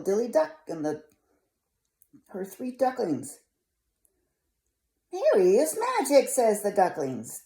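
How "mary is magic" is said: high duckling voices. Acted.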